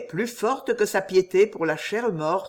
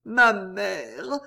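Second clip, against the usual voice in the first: put-on higher girl's voice, rising to a cry; Jeanne crying out; 5 semitones higher, much slower than usual. A narrator's reading. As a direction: call out to the dead mother, overwhelmed. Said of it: "elle répéta". (Jeanne appelle sa mère, voix aiguë et bouleversée)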